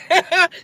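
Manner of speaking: high giggling burst; burst of laughter. Real, not acted. Laughing after hanging up on the ex, giggling. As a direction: crack up laughing.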